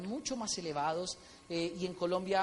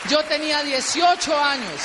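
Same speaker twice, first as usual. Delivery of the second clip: slow, loud shout; emphatic shout; 6 semitones higher, 12 dB louder, slower than usual. Real, not acted. Comedian shouting his age at the audience, slow and loud. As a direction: hammer home the age to the crowd.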